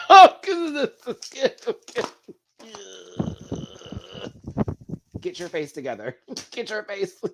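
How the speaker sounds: high, giggly laughter through the words; giggling at himself. Real, not acted. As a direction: laugh at yourself while pulling it together. Laughing at own teary face.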